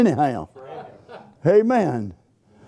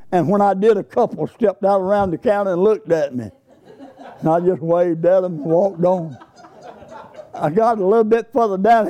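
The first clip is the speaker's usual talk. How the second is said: ordinary talk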